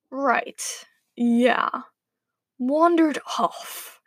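drawn-out, guilty little echo; sheepish. A narrator's reading. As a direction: stall sheepishly, stretching the last words. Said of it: "said the wolf sheepishly".

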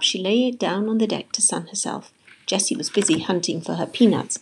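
ordinary delivery

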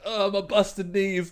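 slow, high groaning complaint; groaning old man. Retold or imitated. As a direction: moan about aching knees. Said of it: "every week I'm like".

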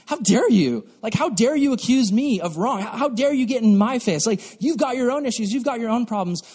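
acted defensive outrage; mock indignation. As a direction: fire back as if wrongly accused. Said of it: "initial gut reaction is just like".

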